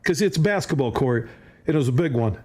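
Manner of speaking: ordinary talk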